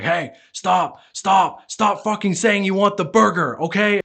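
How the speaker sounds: loud, high exasperated shout; yelling. Acted. Fast-food worker to a pestering customer, yelling. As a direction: yell it like a fed-up burger worker. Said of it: "keep yelling at you".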